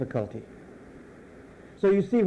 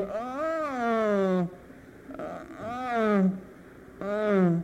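sounds of surrender; 3 semitones higher, 4 dB quieter, much slower than usual. Shown as a demonstration. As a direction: moan like you've already surrendered. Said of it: "Those are sounds of surrender".

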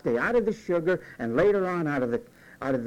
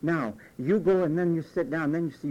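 normal talking voice

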